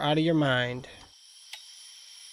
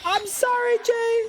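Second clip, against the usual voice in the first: high apologetic. Real, not acted.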